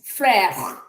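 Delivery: "frère" drawn out with an open è; French pronunciation. Shown as a demonstration. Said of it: "the E is sounded like eh".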